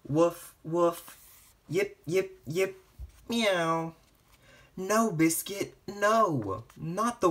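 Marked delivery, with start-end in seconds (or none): 0.1-1.2 a higher, put-on puppy woof; storybook puppy barking; dog bark. Dog barks in picture book. 1.7-2.8 higher, tiny yapping; squeaky puppy yips. Acted. Puppies yip in picture book. 3.3-4.0 a higher put-on little meow; storybook kitten meow. Kitten meows in picture book.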